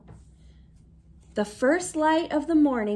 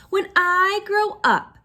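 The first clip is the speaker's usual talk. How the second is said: put-on eager child; 8 semitones higher, 7 dB louder than usual. A narrator's reading. Child groundhog's dream.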